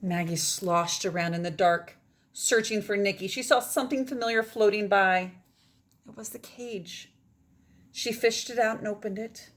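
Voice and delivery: lively read-aloud; story narration. Acted. Teacher reading a picture book to her class, expressive narration. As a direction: carry the children through the tale.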